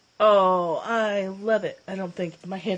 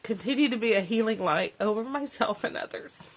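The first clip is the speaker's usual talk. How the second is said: reading on through tears, voice strained; holding it together as she reads; choked-up reading; 3 semitones higher than usual. Real, not acted. Reading the prayer aloud to viewers, choked up. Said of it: "I'm a little bit clubbed".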